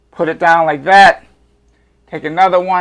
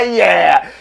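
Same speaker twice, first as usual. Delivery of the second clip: ordinary talk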